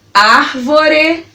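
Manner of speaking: ÁRVORE drawn out as ár-vo-re; syllable by syllable. Shown as a demonstration. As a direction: give each syllable of ÁRVORE full weight. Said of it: "falando elas pausadamente pra você".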